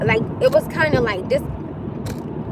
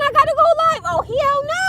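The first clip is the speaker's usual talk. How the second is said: high-pitched comic indignation; squealed outraged refusal; mounting indignation; 11 semitones higher than usual. Real, not acted. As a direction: pile it on, voice way up. Mounting list of reasons to refuse.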